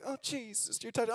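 a hint of delicate weeping; soft sweet crying. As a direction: murmur it like a gentle little cry.

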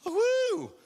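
very high, drawn-out fan squeal; acted starstruck whoop; starstruck "woo". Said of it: "We get a little starstruck".

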